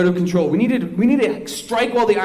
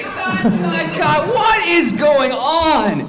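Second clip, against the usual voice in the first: exasperated shout; 7 semitones higher, much slower than usual. Acted. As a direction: shout it at the whole set. Director interrupts the scene.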